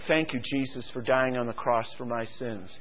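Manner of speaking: starts hushed, builds to fervent; a sinner's prayer swelling up; retold prayer. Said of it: "I said".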